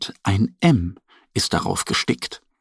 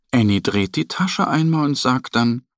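usual speaking voice

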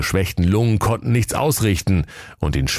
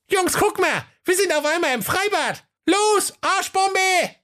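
excited dwarves. A narrator's reading. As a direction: cheer like little dwarves. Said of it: "dwarves in the pool". (hohe Zwergenstimme, begeistert)

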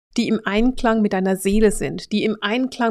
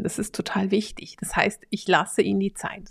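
hushed, unvoiced confidential whisper; 6 dB quieter than usual. Real, not acted. Coach whispering a key point to the viewer. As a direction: lean in and whisper it like a secret.

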